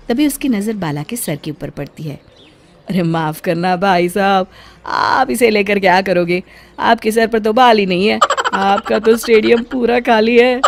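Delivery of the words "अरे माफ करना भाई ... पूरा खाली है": lightly voiced teasing vendor; a hint of a street seller poking fun; mocking comb seller. Comb seller mocks bald customer. (गंजे पर तंज कसता फेरीवाला)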